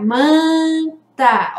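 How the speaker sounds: "manta" with a held nasal first vowel; som nasal. Shown as a demonstration. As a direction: stretch the nasal "man" in "manta", then "ta". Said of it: "Olha o som nasal". ("manta" com o "man" bem nasalizado)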